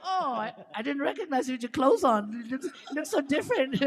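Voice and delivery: excited tease. Retold or imitated. Greeting the mermaid in clothes, loud playful surprise. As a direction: blurt it out delighted and teasing.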